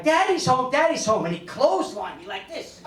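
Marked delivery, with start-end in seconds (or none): 0.0-1.3 put-on high, happy child calling out; excited little kid. Little boy running to greet his dad, high and excited. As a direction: squeal it like a kid running to the door.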